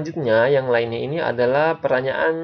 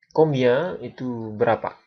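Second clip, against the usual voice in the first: normal talking voice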